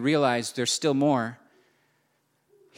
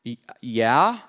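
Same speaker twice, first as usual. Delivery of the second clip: unsure mock answer; 3 semitones lower than usual. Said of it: "And he goes".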